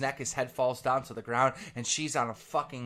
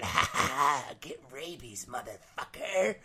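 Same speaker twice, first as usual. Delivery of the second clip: evil bat taunt. Acted.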